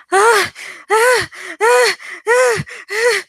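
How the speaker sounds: high, loud repeated screams; uncontrollable screaming. Acted. Boy hears a terrified woman screaming, high repeated screams. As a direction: scream without stopping. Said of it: "Someone was screaming uncontrollably".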